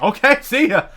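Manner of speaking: high, loud, laughing goodbye; laughing mock farewell; gleeful see ya. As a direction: toss off a delighted exit line. Told to leave, cheerfully leaves.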